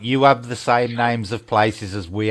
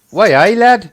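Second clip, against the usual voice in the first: loud, high Geordie "Way, eh, lad?"; hammed-up Geordie shout; Geordie accent; 11 semitones higher, 9 dB louder, slower than usual. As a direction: bark it like a Newcastle lad on a night out. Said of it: "Probably Newcastle".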